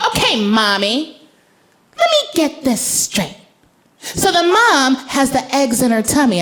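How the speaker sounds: put-on a child working it out; little girl voice. Daughter summarizing to mom.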